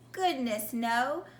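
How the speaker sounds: plain reading voice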